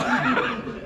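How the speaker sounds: short high laugh; real laugh. Real, not acted. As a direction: laugh briefly at your own joke. Laughs at his own old-men bit.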